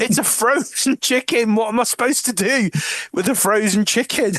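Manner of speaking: high, put-upon prisoner whining; indignant disbelief. Retold or imitated. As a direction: complain about the frozen prize. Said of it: "And she's like".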